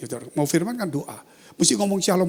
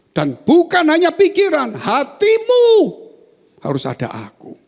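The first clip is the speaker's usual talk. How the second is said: God's voice; 12 semitones higher, 7 dB louder than usual. Retold or imitated. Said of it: "Saya mendengar suara".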